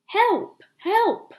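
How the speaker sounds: high, loud cries for help; calling for help. Acted. Wounded man on the path calling to passers-by, loud high cry. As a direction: cry out from the roadside. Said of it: "starts to call for help".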